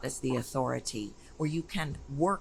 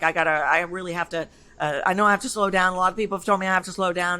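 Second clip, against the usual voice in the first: rushed, loud, raised-pitch babble; fast talker; 4 semitones higher, 7 dB louder, much faster than usual. Retold or imitated. Coach imitating a client who speaks too fast, rushed. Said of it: "somebody speaks really fast".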